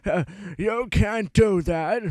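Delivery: high, slow, drawn-out mock scolding; pissed-off teacher. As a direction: imitate an old teacher's exasperated telling-off. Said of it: "when she gets pissed off".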